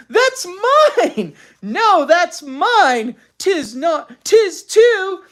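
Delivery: high, loud back-and-forth; squabbling children. A narrator's reading. Twins fighting over clothes, shouting contradictions. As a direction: trade shouted childish retorts.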